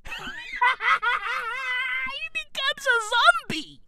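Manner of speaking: high, soft voice through laughter; laughing. Real, not acted. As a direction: laugh your way into the words. Cracking up at his own description.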